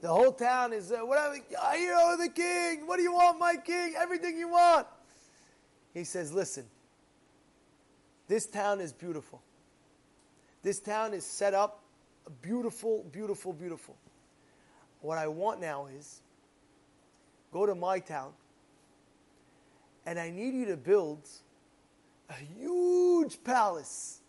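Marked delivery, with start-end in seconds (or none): 1.0-4.9 high, loud mock shouting; fawning clamour. Whole town offering the king anything, high shouted eagerness. 22.3-24.2 "huge" drawn out long and high; stress on huge. Retold or imitated. King ordering his builders, "huge" stretched out high.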